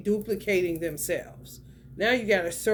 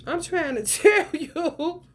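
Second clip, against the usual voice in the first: laughing; 10 semitones higher, 5 dB louder, slower than usual. Real, not acted. Laughing mid-line in reading.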